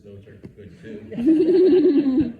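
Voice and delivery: laughing loud and high, slowed. Real, not acted. Storyteller joking with the audience, laughing. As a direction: burst out laughing through the words.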